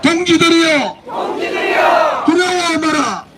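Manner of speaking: roaring rally chant, slow and high; roaring rallying speech. Real, not acted. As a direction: shout each slogan to the crowd. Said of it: "이 구호할 때마다 힘이 솟는 겁니다". (우렁찬 구호 외침)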